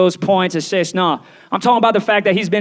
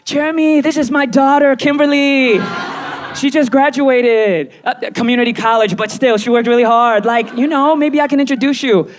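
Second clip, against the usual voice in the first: higher-pitched matchmaking auntie; 4 semitones higher than usual. Acted. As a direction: push your daughter proudly on a celebrity.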